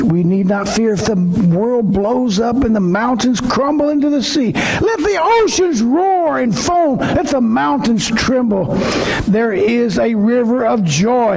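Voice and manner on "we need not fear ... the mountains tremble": reading the psalm, rising into a shout; building psalm preaching. Acted. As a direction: build the verse toward the climax.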